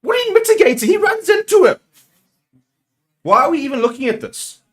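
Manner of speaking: very high, loud outrage; real high-pitched furious protest; furious shouting. Real, not acted. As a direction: yell in disbelief at the officials. Still mad, challenges the commentators.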